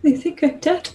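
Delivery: high, tearful goddess voice; a lost god pleading; weeping goddess. Acted. Goddess answering the warrior Sedna, tearful and high.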